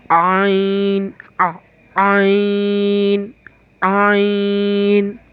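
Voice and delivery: आइन drawn out very slowly from the throat; slow throaty आइन; throat ain. Shown as a demonstration. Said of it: "आइन तक खींचना है".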